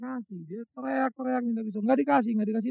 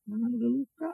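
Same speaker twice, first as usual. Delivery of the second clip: ordinary talk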